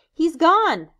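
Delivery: high, loud frustrated cry; dismayed shout. Acted. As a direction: call it out sharply. Jack finds boar escaped.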